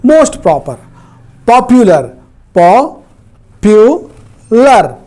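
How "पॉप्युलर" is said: "Popular" broken into three slow, separated syllables. Shown as a demonstration. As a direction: pull "popular" apart, pausing after each syllable. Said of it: "इस तरह से एक से अधिक syllable हैं".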